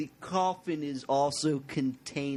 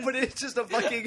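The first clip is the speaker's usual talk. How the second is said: laughing; 8 semitones higher than usual. Real, not acted. Laughing at the granola bar joke.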